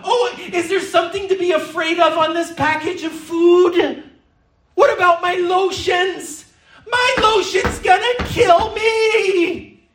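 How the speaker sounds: high, laughing mock panic; hammed-up frightened whine over lotions; mock fear. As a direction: ham up a paranoid shut-in. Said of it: "balled up in the fetal position".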